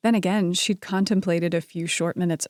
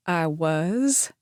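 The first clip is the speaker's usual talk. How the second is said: mock confusion. A narrator's reading. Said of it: "His expression was one of mock confusion".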